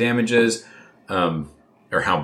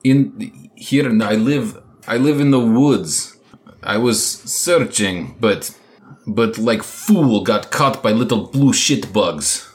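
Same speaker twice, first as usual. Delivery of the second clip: put-on lightly accented Ivan; 3 semitones higher, 3 dB louder than usual.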